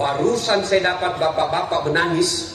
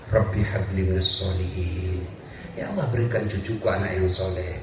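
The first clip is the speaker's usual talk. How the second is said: put-on low, soft murmured prayer over the child; 11 semitones lower, 5 dB quieter than usual. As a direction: pray it gently while spooning food. Praying while bathing grandchild.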